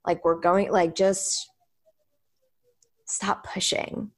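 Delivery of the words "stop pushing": whispering. Real, not acted. Soothing advice to slow down.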